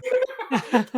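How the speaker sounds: loud high-pitched laugh; high burst of laughter. Real, not acted.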